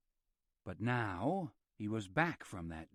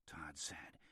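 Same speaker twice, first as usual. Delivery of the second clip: ordinary delivery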